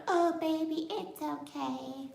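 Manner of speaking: high, then soft, slow mock comforting; a hammed-up soothing partner. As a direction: coo it softly like a sucker consoling. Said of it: "you're gonna be like".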